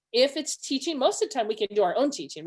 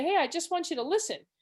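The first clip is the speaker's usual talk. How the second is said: higher-pitched, mildly frustrated plea; 7 semitones higher than usual. Acted. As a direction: say it with a touch of exasperation. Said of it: "I can get frustrated with him".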